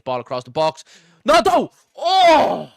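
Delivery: building to a loud, high-pitched scream; excited goal-chance shout. Real, not acted. Football commentator calling a striker's chance in the box, excited scream. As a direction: erupt as the shot comes in.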